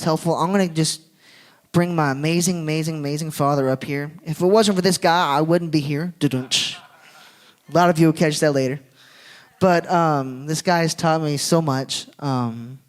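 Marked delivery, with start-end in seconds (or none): none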